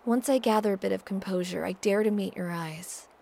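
ordinary delivery, nothing marked

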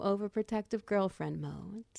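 ordinary talk, nothing marked

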